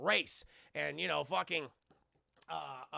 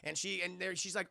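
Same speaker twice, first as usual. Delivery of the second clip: ordinary talk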